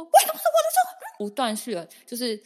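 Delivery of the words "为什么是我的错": high, loud outburst breaking into a laugh; retold outburst. Daughter yelling back at her mom, high loud laughing shout. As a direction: replay the yell at mom, half laughing. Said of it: "我的音直接爆掉".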